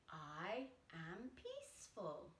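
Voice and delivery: soft affirmation. Shown as a demonstration. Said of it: "we're gonna say".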